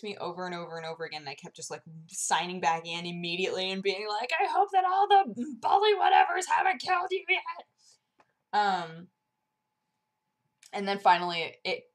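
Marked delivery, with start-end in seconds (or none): 4.4-7.7 high, loud mock panic; frantic retold worry. Signing back in to a co-player, mock frantic. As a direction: blurt it high and urgent.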